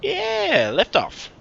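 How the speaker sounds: high, loud whoop of joy; excited cheer. Real, not acted. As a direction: cheer the liftoff like a fan. Rocket lifts off, cheering.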